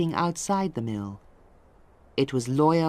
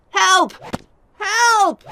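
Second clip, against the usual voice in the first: much higher, loud panicked cries; cry for help; 9 dB louder than usual. A narrator's reading. Wakem being beaten on the ground, panicked shrieks. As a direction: scream for help as the whip comes down. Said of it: "Wakem shouted".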